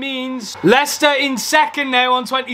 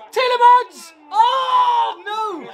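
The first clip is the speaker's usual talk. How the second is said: very high cry of near-miss agony; anguished cry; 8 semitones higher, much slower than usual. Real, not acted. Fan watching a chance missed, a very high anguished cry. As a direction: cry out as the chance goes begging.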